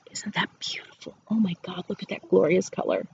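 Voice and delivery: hushed, breathy whisper of wonder; awed whisper. Real, not acted. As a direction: whisper it like sharing a secret treasure. Admiring the reversed hat colours.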